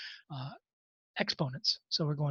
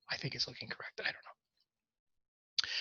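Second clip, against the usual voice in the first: whispered aside; 5 semitones lower, 14 dB quieter than usual. Real, not acted. Teacher muttering doubt to self.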